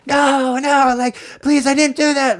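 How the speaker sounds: very high-pitched mock pleading wail; imitated screaming kid. Scammer kid pleads in the background, mock screaming. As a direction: wail like a kid caught out, hammed up.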